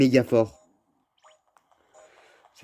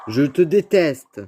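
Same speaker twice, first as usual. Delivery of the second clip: mock annoyed; 5 semitones higher, 6 dB louder, much slower than usual. Real, not acted. Annoyed at the game.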